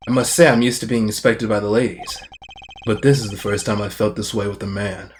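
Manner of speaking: low, soft, smooth-talking Gavin; suave flirt. Acted. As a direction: purr it like a ladies' man. Voices Gavin in the game.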